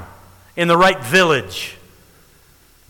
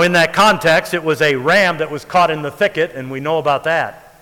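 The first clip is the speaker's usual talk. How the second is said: ordinary delivery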